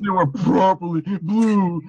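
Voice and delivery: hammed-up weepy quote, drawn out; mock tearful. Joining the co-host's joke about the misty-eyed flag speech, mock tearful. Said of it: "like, crying".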